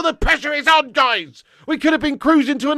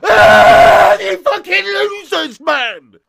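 angry yell; 7 dB louder, much slower than usual. Real, not acted. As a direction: roar in frustration at your own team. Angry outburst at losing team.

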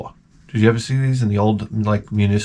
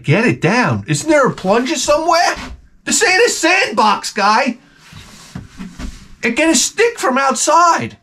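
much higher, slow, disbelieving outburst; incredulous; 12 semitones higher than usual. Retold or imitated. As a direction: squeal it in shocked disbelief. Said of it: "I'm like".

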